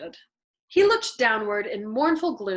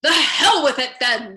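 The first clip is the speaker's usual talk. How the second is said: loud, slow, defiant dismissal; in a huff; 3 semitones higher, 9 dB louder than usual. A narrator's reading. Colonel giving up on the prayer meetings, huffy and loud. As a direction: stamp it down with sulky defiance. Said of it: "asserted in a huff of independence".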